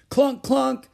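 high, loud machine clunks; yelling. A narrator's reading. Ramona jumping down from the chair, yelling machine noises. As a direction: yell it out like a pile driver. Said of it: "yelled Ramona".

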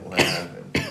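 ordinary talk

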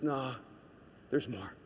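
low, soft contradiction; gently contradict, dropping low and soft; quiet inner voice. Acted. Inner voice answering despair, low and soft. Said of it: "there's this voice that says".

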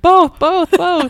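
high, loud bursts on each word; excited insistence. Retold or imitated. Retelling an eager message to a podcasting friend, excited.

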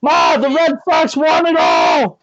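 high, slow, loud shout; a kid bellowing the big win; excited kid yell. Pretend. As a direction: holler the news to Mom. Kid shouting news to Mom.

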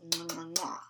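two smacking air kisses; blowing kisses. Real, not acted. Signing off to viewers.

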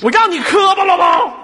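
high, loud interruption; impatient mock-angry shout. Host cutting off stammering caller, impatient shout. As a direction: cut in with exaggerated exasperation. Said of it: "你不要那么着急".

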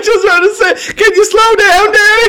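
put-on high, wailing little boy; whiny crying kid. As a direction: cry out like a scared kid.

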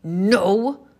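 loud, huffy refusal; grumpy storybook huff. A narrator's reading. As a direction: huff it out like a cross little panda. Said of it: "huffed Pom Pom".

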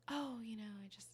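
hushed, breathy mock confession; whispered confession. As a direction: whisper it shyly. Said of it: "the context in which I would confess".

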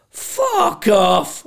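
loud, high-pitched burst of disbelief; retold shout. Waking bloke told about the Scottish housemate, shouted dismissal. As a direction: shout it out in one big burst.

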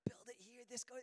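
imitated toddler's whispered protest; whispering. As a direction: whisper insistently like a small child. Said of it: "he's kinda whispering".

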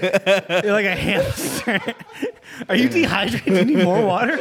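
laughing through the words; giggling while teasing. Real, not acted. Laughing at co-host drinking.

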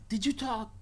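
high-pitched interrogation; questioning handler. Retold or imitated. Handler questioning the fired bird, high voice. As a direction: ask it pitched well up. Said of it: "he's like".

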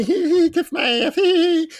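high, childish mock giggle; silly giggle. Mocking his younger self giggling at swearing, high titter. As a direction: giggle through it like a corny kid. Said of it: "I giggled".

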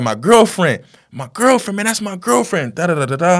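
high, loud distraught cry; retold anguish. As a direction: imitate a young man wailing over a loved one. Acting driver finding his girlfriend.

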